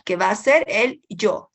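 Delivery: ordinary talk, nothing marked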